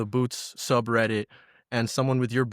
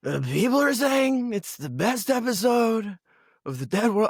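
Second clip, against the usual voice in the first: mock hype; 11 semitones higher than usual. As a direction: hype the episode in a silly voice. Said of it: "mock hype about own show".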